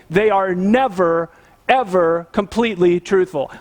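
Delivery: normal talking voice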